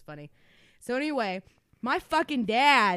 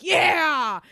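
triumphant yeah; 7 semitones higher, 6 dB louder than usual. Retold or imitated. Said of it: "he's like".

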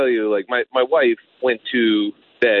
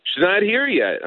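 retold wife; 9 semitones higher than usual. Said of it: "She goes".

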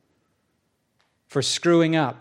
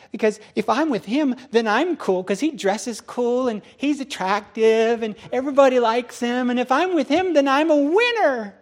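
high, building starstruck gush; gushing fans; 6 semitones higher than usual. Acted. Fans explain why they adore Tobiah, gushing. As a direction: gush higher and higher like a smitten fan.

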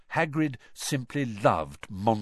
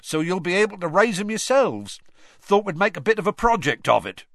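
higher, louder, different gruff voice, proud; proud Hagrid; 10 semitones higher, 6 dB louder than usual. A narrator's reading. Hagrid pitches his project to students, proud. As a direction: hurry on eagerly, pleased with them. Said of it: "said Hagrid proudly".

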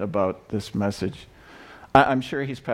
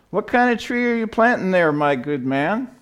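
nobleman calling out; 8 semitones higher than usual. Acted. Nobleman calls out to old man.

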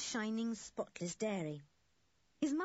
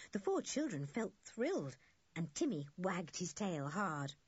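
plain reading voice

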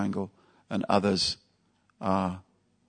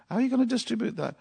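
incredulous question; much faster than usual. Retold or imitated. Imitating puzzled devotees' question.

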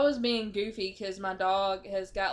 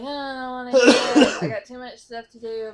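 whiny reluctance; 4 semitones higher, 12 dB louder than usual. Retold or imitated. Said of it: "I'm usually like".

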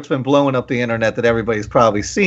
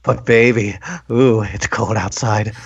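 creepy man voice; 5 semitones lower than usual. Acted. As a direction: lean in like a leering seducer. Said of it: "in my most creepy man voice".

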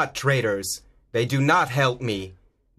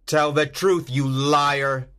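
higher, insistent accusation; angry demand; 5 semitones higher, slower than usual. A narrator's reading. Zorro confronts the silent captain, angry demand. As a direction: demand a confession from a liar.

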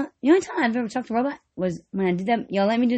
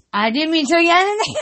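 normal talking voice

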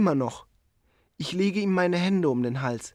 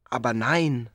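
soothing; 6 semitones lower than usual. Retold or imitated. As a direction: hush him gently. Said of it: "sage ich".

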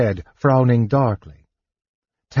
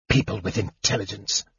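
snarl; 6 dB quieter than usual. A narrator's reading. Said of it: "Raistlin snarled".

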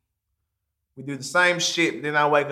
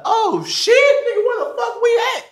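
high, loud laughing amazement; stunned by the mansion; shocked laughing exclamation; amazed disbelief; 6 dB louder than usual. Retold or imitated.

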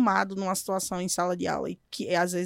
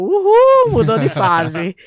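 excited cheer; 11 dB louder, slower than usual. Retold or imitated. Teacher cheers the boy for reaching the next level. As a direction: celebrate the kid's win out loud.

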